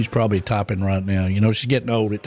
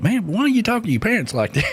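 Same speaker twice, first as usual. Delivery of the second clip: higher, fast, half-mocking disbelief; shocked question; 11 semitones higher, faster than usual. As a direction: retell the shock of hearing him yell at his parents. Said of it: "I'm like".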